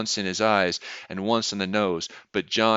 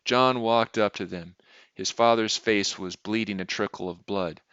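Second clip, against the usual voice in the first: plain reading voice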